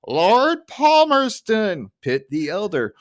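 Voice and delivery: high, loud, drawn-out bar-argument bellow; Barney. Retold or imitated. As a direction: stretch the name out, then drop back. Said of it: "it's basically Barney going".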